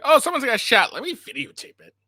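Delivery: sarcastic mock bystander voice. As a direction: sneer at the people filming.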